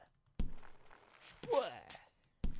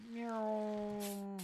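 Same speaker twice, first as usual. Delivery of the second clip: cat meow; 3 semitones higher, 3 dB quieter than usual. Pretend. Gamer meowing like a cat.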